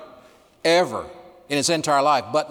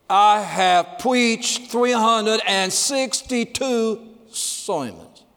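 Alan Oggs' speech; 9 semitones higher, 3 dB louder, much slower than usual. Retold or imitated. Preacher imitating Alan Oggs to the congregation, high and slow. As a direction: drag each word out high and slow, for emphasis. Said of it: "He said it like this".

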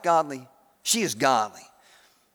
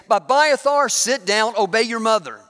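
raised, higher scolding call; scolding parent; 5 semitones higher, 5 dB louder than usual. Acted. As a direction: call the kid to order, loud. Scribe scolding his son.

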